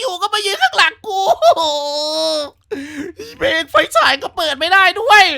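real nervous fright; shaken panic. Real, not acted. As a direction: panic after the scare. Still panicked after scare.

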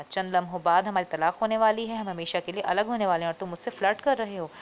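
ordinary delivery, nothing marked

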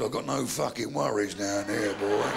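put-on very low old man's voice; old grandad. Grandad answering little boy in bath, low-voiced. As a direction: drop right down for grandad's reply.

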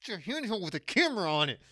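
usual speaking voice

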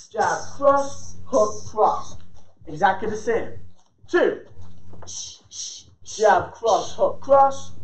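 snappy combo calls on the beat; boxing coach calling combos. Real, not acted. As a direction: call the punches in rhythm.

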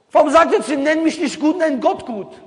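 worked-up preacher's challenge; heated rhetorical question. Real, not acted. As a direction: press the crowd with it. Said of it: "rhetorical challenge to audience".

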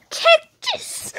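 high, loud attack cry; high battle shout. Acted. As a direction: yell it as you strike.

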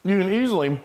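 talking through a mouthful of food; words round a bite of food; mouth full. Real, not acted. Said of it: "Talking with your mouth full".

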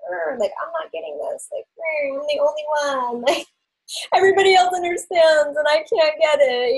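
high-pitched, exaggerated confused complaining; mock frustrated whine. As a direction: whine it like the only one left out. Voicing a confused person pulling back.

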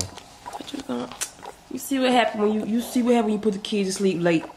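hushed bedtime whisper. Real, not acted. Husband whispering to wife in bed about her pajamas.

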